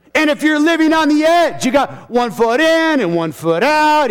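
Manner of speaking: high, loud pulpit challenge; full-force preaching. Real, not acted. Preacher warning those living on the edge, preaching high and hard. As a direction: press the warning on the congregation, pitched high.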